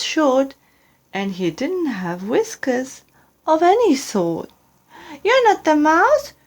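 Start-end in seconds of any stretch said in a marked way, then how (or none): 5.2-6.3 much higher, excited little monster voice; high eager child creature; Gruffalo's child. Acted. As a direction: squeak it out like a curious young monster. Child questions the owl.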